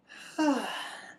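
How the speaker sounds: loud exasperated sigh; high, audible sigh of frustration; heavy sigh. Real, not acted. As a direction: let out a big, falling sigh. Recalling a frustrating argument.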